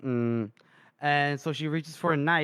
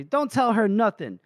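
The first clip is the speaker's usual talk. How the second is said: higher-pitched retold warning; acted-out henchman's objection; mock warning; 6 semitones higher, 3 dB louder than usual. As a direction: cut in to shut someone up, playfully acted. Henchmen cut in to silence someone.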